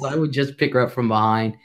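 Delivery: usual speaking voice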